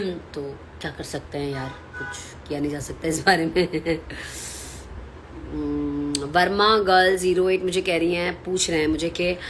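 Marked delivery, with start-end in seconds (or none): 4.0-5.4 quiet, breathy chuckle; soft laugh. Real, not acted. Host shrugging off the bad weather, soft laugh. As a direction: laugh it off softly.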